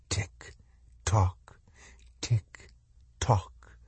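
soft, evenly spaced whisper; hushed ticking, a pause after each word; whispered sing-song rhythm. A narrator's reading. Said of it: "voice continued in a merry sing-song rhythm".

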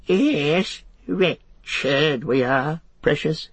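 high, drawn-out creature whine; whining. A narrator's reading. Gollum pleading with the hobbits, whining. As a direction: whine it out wretchedly. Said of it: "he whined".

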